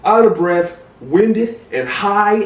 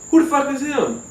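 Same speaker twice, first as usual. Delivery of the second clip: incredulous cousin; 8 semitones higher than usual. Retold or imitated.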